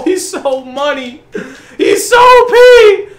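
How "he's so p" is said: drawn-out hype. Real, not acted. Hyping his player.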